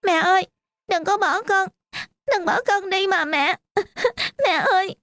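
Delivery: little daughter sobbing, begging her mother not to leave; crying child. Acted. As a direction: wail for mom through tears. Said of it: "Đứa con gái nhỏ của bà bật khóc".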